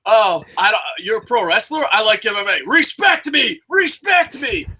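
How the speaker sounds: hammed-up fan shouting for respect; skit MMA fan. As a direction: yell respect me like a touchy fan. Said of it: "reenact my skit".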